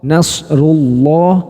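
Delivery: syahidallah with a heavy lam, slow; slow thick syahidallah; read thick. Shown as a demonstration. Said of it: "Kalau tebel".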